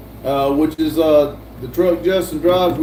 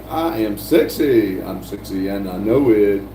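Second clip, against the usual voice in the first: hammed-up sexy boast; 8 semitones lower than usual. Streamer hams up a chat boast, mock sexy. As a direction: drop it deep and draw it out.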